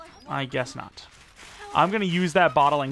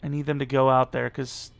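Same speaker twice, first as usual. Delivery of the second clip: normal talking voice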